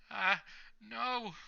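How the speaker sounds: high panicked gasp of denial; freaking out. A narrator's reading. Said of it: "Twice is still freaking out".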